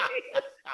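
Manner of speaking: high, airless wheeze of laughter; helpless silent laugh; high breathless laugh. Real, not acted. Cracking up at the line.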